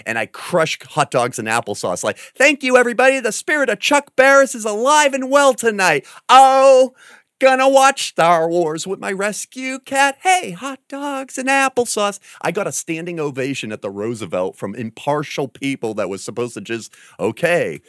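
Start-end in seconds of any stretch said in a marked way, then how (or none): 2.3-12.2 high, loud showbiz host voice, slowing at the end; big mock announcer voice; hammed-up showman voice. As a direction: play the flamboyant stage emcee.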